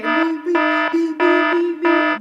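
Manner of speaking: loud, high, even beeps; radar alarm beeping. Retold or imitated. Warning her radar is going off, loud high alarm beeps. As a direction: sound the alarm in piercing repeated beeps.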